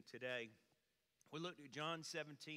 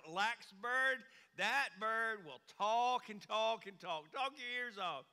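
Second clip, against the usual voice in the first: eager salesman pitch; 4 semitones higher, 6 dB louder than usual. Retold or imitated. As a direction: sell it hard, coaxing.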